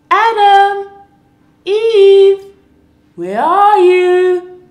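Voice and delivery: loud, high, slow call across the garden. Acted. God searches the garden, calling loudly. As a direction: call them from far away.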